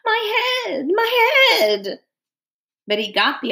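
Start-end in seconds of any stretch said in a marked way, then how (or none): 0.0-2.0 high-pitched, wailing whimper; whimpering ape. A narrator's reading. Ape moaning about its aching head, high whimpering. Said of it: "heard the apes once more whimpering".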